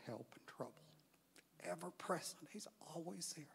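a hushed, awed aside about God always there; hushed whisper. Real, not acted. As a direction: whisper it softly, lingering on ever-present. Dwelling on scripture word, hushed.

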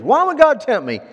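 high, loud challenge; questioning believer. Retold or imitated. Believer asking the pastor about temptation, protesting. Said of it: "I've had believers".

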